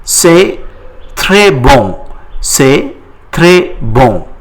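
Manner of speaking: C'est très bon, slow and clear; slow model. Shown as a demonstration. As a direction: spell it out slowly for learners. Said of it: "How do you say it in French?".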